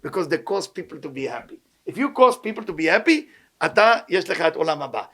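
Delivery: usual speaking voice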